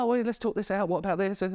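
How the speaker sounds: high, bright-eyed eagerness, mock keen; delighted offer to talk it through; eager to help. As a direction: play the keen helper jumping in. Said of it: "I'm like".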